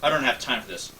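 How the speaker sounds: usual speaking voice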